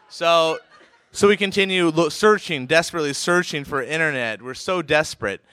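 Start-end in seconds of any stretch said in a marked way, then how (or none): none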